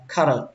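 carrot with open "ah" and a released t; fourth pronunciation. Shown as a demonstration. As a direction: say "carrot" as KHA-rot, ending on t. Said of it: "Cách thứ tư là".

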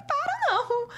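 very high-pitched retold refusal; mock defiant outburst. Model refusing colleagues' calls to calm down, high defiant outburst. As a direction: push back high and insistent.

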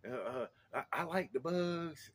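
put-on high, halting child voice; imitated little boy. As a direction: boast like a small kid with big news. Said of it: "He's like".

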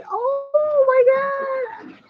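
high, long drawn-out exclamation; gushing disbelief. Real, not acted. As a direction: stretch it out high, overwhelmed by the compliment. Reacts to praise of her accent. (mataas at pahabang pagkamangha)

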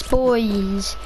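usual speaking voice

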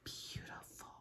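breathy whisper of admiration; soft whisper of wonder; whispered awe. Real, not acted. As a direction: sigh it out in wonder. Marvelling at colours.

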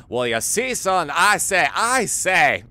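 loud, high southern blowhard; blustering southern cartoon voice; Foghorn Leghorn. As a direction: boom it out in a cartoon southern drawl. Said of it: "listen to a lot of Foghorn Leghorn".

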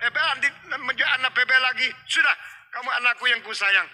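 loud, high, emphatic reassurance; acted indignant, protective father; father waving away fears. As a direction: brush the worry aside at full voice. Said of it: "Papa bilang".

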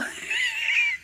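squealing burst of laughter. Real, not acted. Vlogger cracking up after singing Frosty, high squealing laugh. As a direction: shriek of laughter at herself.

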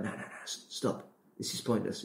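soft, unvoiced nagging whisper. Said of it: "it just kinda whispers in your ear".

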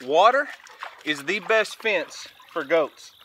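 normal talking voice

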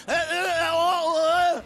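waitress shouting order to bartender; calling out. Acted. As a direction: shout the vowels across the bar. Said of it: "ropar hon bort till bartendern".

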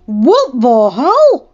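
slow, loud shocked yell. A narrator's reading. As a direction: yell it at the screen. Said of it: "Dustin yelled".

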